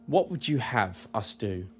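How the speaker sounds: soft, solemn celestial voice; hushed, measured question; celestial being. Acted. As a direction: speak quietly, like a grave divine visitor. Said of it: "looks you in the eye and he says".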